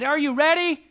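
playful high-pitched build-up; playing with baby. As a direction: hype up a one-year-old for a game. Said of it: "I said".

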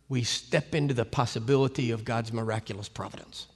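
normal talking voice

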